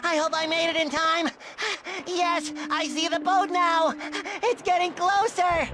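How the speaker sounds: breathless, eager lookout; out of breath. Acted. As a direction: gasp between phrases after running. Runner arrives out of breath.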